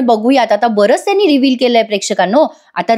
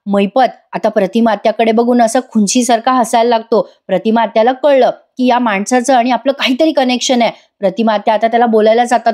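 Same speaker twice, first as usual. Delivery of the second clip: ordinary talk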